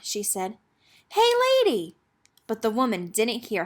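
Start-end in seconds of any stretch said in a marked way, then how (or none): 1.1-2.0 high, loud call after someone. A narrator's reading. Girl calls after the old woman, loud and high. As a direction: shout to catch her attention.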